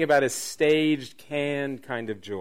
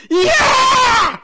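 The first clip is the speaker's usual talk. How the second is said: long, very high crowd scream; screaming cheer; 13 dB louder than usual. Acted. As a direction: scream in victory like cheering troops. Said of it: "everybody's screaming".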